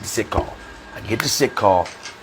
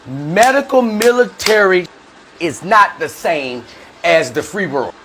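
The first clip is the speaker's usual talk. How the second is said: slow and deliberate, starting high; slow emphasis; 7 semitones higher, slower than usual. Real, not acted. Veteran warns listeners about army medicine, slow and emphatic. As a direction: draw each phrase out to drive it home.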